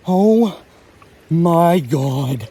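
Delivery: loud high yelp of joy; real thrilled shriek at the catch; excited shout. Real, not acted. Catching a big trophy trout.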